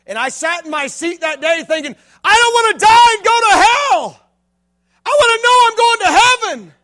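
high, loud, slow, near shout; desperate cry. Retold or imitated. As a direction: cry out the longing for heaven. Boy's desperate thought.

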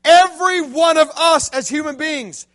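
fiery youth-pastor preaching; full-force preaching. Acted. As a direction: drive the story home to the crowd.

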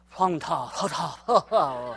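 low, soft, breathy fake Korean; mock Korean, all breath. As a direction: puff out pretend Korean on heavy breaths. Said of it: "I know Korean's real breathy".

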